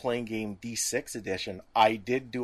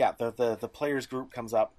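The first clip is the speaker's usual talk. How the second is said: normal talking voice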